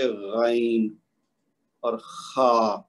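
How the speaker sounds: غین and خا drawn out slowly from the upper throat; slow, careful, throaty غین then خا; throaty ghain and kha demo. Shown as a demonstration. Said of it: "جہاں سے گلا ہمارا شروع ہو رہا ہے".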